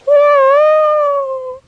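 long high whooo of a distant train; high, loud drawn-out train hoot; train whistle. Acted. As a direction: imitate a far-off locomotive whistle.

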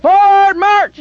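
barked command. Acted. The captain ordering his men off, loud command. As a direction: call the march.

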